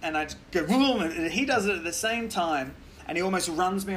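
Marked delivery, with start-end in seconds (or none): 0.6-1.0 mock engine noise, higher and louder; engine vroom. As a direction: vroom like a revving motorbike. Mimics engine noise overtaking.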